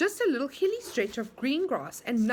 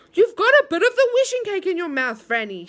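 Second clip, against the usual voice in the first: higher, louder urgent call; excited shout; 7 semitones higher, 8 dB louder than usual. A narrator's reading. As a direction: shout it across to her. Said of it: "shouted Moonface".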